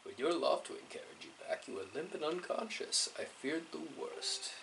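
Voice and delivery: put-on hushed, worried elderly father; a gentle, husky old mentor; soft old man voice. Voices the headmaster character.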